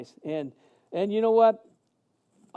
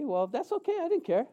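mock unbothered; 7 semitones higher, 4 dB quieter than usual. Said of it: "And I was like".

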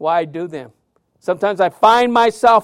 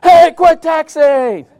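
high, loud, slow shout; yell out window; 9 semitones higher, 9 dB louder, slower than usual. Retold or imitated. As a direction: bark it at a driver through the car window. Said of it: "He says".

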